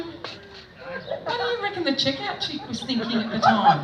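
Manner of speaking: high-voiced retold question; high-pitched retelling. Comic asking him about the checkout chick, high-pitched. Quoting her exchange with him.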